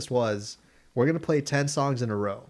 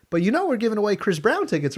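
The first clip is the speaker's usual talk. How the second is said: high, louder chirpy DJ; radio host voice; 10 semitones higher, 4 dB louder, faster than usual. Retold or imitated. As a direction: pitch tickets like a perky host.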